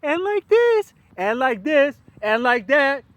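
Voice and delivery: shouted falsetto way up high; very high loud voice. Shown as a demonstration. Demonstrating vocal ranges to listeners, very high loud voice. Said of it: "Now I'm talking like this".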